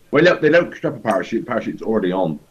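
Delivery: usual speaking voice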